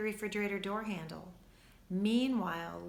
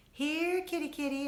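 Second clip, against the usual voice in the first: high, louder calling of a kitty; sing-song call for the cat; calling boy; 7 semitones higher, 4 dB louder than usual. A narrator's reading. Boy calling the cat in the kitchen, high sing-song. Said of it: "a boy's voice called".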